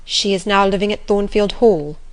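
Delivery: usual speaking voice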